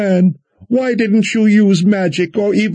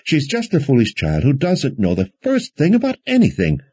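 higher-pitched, fussing prattle; worried prattling; 6 semitones higher than usual. A narrator's reading. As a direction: ramble on, dismissive and worried. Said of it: "prattling with her worry".

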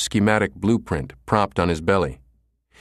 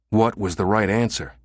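usual speaking voice

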